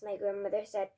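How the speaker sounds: usual speaking voice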